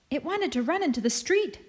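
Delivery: put-on high, bouncy child's explanation; excited. A narrator's reading. Girl adding to the kitten rescue story, excitedly. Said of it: "adds Marie excitedly".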